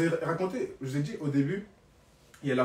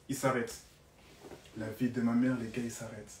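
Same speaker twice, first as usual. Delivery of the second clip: ordinary talk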